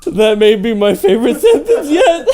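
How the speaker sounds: squealing, high laughter while speaking; real helpless laughter; laughing hard. Real, not acted. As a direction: crack up and push the words out. Cracking up at the fanfic.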